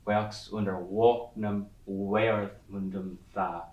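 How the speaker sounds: put-on slow, deliberate Old English verse, beats marked; slow Old English recitation. Shown as a demonstration. Teacher reciting Beowulf to class, slow and measured. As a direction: chant the half lines slowly to show the alliteration. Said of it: "And the fourth beat".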